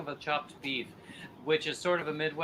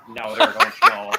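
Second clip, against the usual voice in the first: loud, high mock Minnesota accent; 9 semitones higher, 12 dB louder than usual. Joking about childhood in Minnesota, hammed-up Minnesota accent. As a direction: laughingly ham up a Minnesota accent. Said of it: "blending the whole Minnesota thing".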